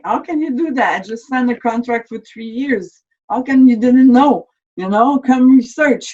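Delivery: high, loud indignant protest; retold angry outburst. Discharged soldier protesting to the office, loud and indignant. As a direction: fling the question back at them in outrage.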